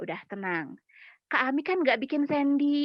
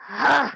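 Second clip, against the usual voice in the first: loud exhale; 5 dB louder than usual. Acted.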